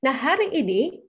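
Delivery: "hari ini" lifted, with a rise at the end; lively intonation. Shown as a demonstration. Presenter opening the session, lively intonation. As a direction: announce "Nah, hari ini" with energy. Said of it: "akan berbeda dengan".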